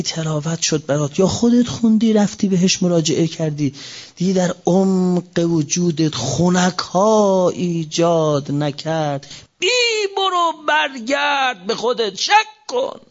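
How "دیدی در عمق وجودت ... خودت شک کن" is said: tearful cry. Acted. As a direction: break into a pleading cry. Building to crying shout.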